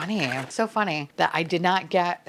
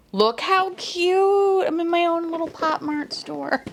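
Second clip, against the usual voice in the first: gleeful gushing; 10 semitones higher than usual. Real, not acted. Collector showing her own little store, gleeful squeal. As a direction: squeal it, stretching each word. Gushing over the full set.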